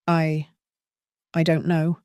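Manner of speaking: halting, hesitant stammer. Acted. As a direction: stumble over the words nervously. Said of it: "I stammered".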